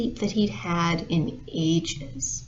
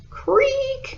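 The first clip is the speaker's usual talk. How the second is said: very high squeaky creak; voiced imitation of a high creak; creaking floor. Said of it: "And the floor went".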